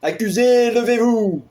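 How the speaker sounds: courtroom order. Acted. Court official summoning the accused to stand, formal call.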